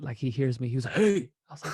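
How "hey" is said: sharp, loud, higher "Hey!"; angry shout. Retold or imitated. Kali, disturbed with a lady in his tent, shouting angrily. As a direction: bark it out, caught off guard and annoyed. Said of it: "Kali gets mad".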